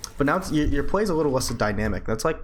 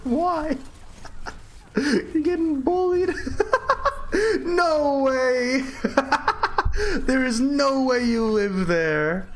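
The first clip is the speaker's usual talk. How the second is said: high, squealing laugh through the words; shrieking laughter; slower than usual. Real, not acted. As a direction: crack up helplessly while talking. Laughing at player being bullied.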